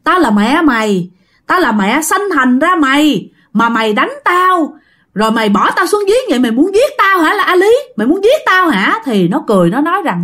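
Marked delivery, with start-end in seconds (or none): 0.0-9.0 high-pitched indignant scolding, no full shout; mock-angry mother's protest, pitch climbing; retold scolding outcry. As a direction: cry out in reproach at a cruel child. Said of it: "bả mới la, bả mới chửi".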